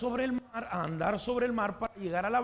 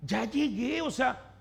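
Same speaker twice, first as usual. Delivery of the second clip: very high, strained protest; exasperated mom; 7 semitones higher, 3 dB louder, much slower than usual. Acted. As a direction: plead with pestering kids to back off. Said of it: "¿Qué crees que dice mamá?".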